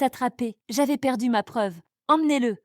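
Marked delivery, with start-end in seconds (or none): 2.1-2.6 higher, louder command; giving orders. A narrator's reading. Husband orders guards to take the intruder away, commanding. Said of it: "ordonna Étienne".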